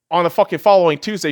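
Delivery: ordinary talk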